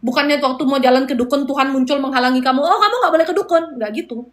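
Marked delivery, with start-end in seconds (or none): 2.6-3.6 higher, lightly acted warning; pitched-up pretend prohibition; mock forbidding. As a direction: step in to stop someone, playfully. Acted divine intervention forbidding a visit.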